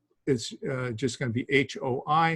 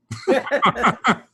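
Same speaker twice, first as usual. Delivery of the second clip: high, loud laugh at his own quip; 11 semitones higher, 5 dB louder than usual. Real, not acted. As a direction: laugh at the irony. Laughs at rarely do joke.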